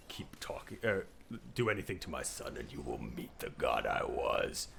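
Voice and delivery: low, deep threatening growl; menacing Kratos. Retold or imitated. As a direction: threaten him in a deep rumble. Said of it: "And he's just like".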